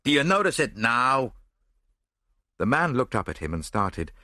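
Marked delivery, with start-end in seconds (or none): 0.0-1.3 a forceful, challenging roar; moderately raised voice, demanding. A narrator's reading. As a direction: roar the question at him. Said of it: "roared Gregory Rolfe".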